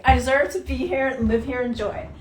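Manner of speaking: loud, firm chant while stamping; a defiant shout of self-worth; stamping affirmation. Shown as a demonstration. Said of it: "I'm gonna go around in circles".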